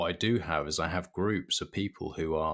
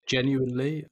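higher and louder, leaning hard on Genuinely; emphatic genuinely; 7 semitones higher, 5 dB louder than usual. Real, not acted. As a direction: swear it's true with a burst. Stressing sincerity of holiday joy.

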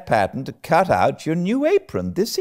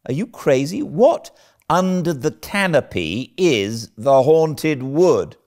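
baffled indignation; 12 semitones higher, 6 dB louder than usual. Acted. Marilla demanding what the haunted wood is, indignant.

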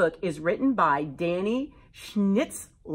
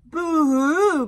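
mock growl; 9 semitones higher, 7 dB louder than usual. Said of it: "growled the monster".

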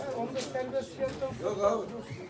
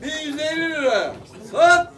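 auctioneer. Acted. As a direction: call the bids out like an auctioneer. Auction calling bids.

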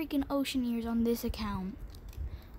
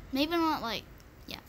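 ordinary talk, nothing marked

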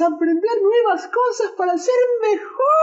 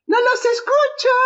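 rallying call; 7 semitones higher than usual. Real, not acted. Prediger heizt Kindern ein.

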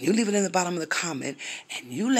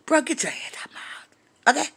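whisper; 11 semitones higher than usual. Retold or imitated. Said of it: "told him".

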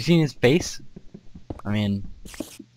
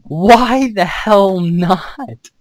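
dramatic defiance; 8 semitones higher, 8 dB louder, much slower than usual. Pretend. Defiant mock-dramatic retort.

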